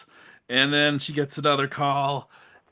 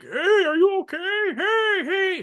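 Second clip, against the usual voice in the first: much higher, whiny mock killer voice; creepy boyfriend; slower than usual. Boyfriend calling to the hiding girl, high and whiny. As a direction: whine it like a creepy stalker. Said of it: "He's like".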